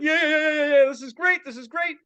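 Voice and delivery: high, rapid-fire yeahs, loud then fading; eager agreement. Retold or imitated. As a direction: egg him on with mock giddy delight. Acts out own eager agreement.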